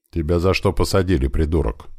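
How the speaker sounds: usual speaking voice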